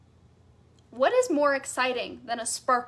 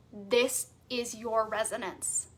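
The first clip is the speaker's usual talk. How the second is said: normal talking voice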